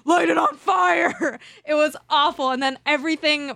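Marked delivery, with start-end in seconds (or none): 0.1-1.4 shouted slowly, pitch up, in a panic; panicked shout. Retold or imitated. To her boyfriend over the tick, a slow panicked shout. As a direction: yell it out in panic.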